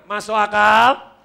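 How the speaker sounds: drawn-out call with a rising end; loud check question. Real, not acted. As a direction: throw it out loudly to the crowd. Calls for the audience's response. (bertanya lantang, nada naik di akhir)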